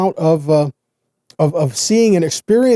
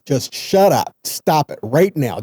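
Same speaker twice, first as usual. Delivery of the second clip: slow, blunt shut-up order; a hint of a stern telling-off; blunt command; slower than usual. Moses telling the people to shut their mouth, mildly stern.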